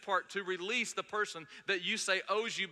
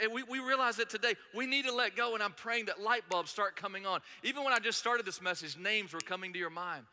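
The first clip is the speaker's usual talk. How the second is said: usual speaking voice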